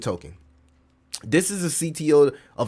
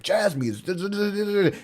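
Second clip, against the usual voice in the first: mock dumb crowd voice; 7 semitones higher than usual. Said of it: "everybody was like".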